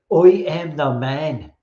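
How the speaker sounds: slow, drawn-out bad Irish accent; terrible Irish accent. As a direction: mimic an Irish wrestler with a hammed-up Irish accent. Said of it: "that's a terrible Irish accent".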